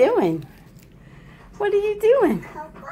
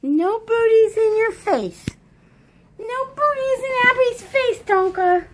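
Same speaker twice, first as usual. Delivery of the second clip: voicing the toddler; 12 semitones higher than usual. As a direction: say it for her, bossy and squeaky. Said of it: "You tell him".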